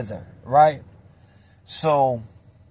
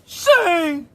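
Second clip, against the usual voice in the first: high calling yell; high shout of a name for help; yelling for his homie; 13 semitones higher than usual. Retold or imitated. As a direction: call out for backup across the pod. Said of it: "He's yelling for his homie".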